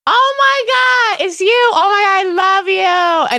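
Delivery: high, slow, delighted gush; Teresa Giudice. Retold or imitated. Teresa realizing her impersonator is there, high gush. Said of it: "I'm like".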